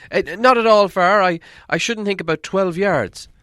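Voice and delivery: high, loud, hesitant hobbit. Acted. Hobbit answering the dwarf leader, high and hesitant. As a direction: stammer it out high and eager.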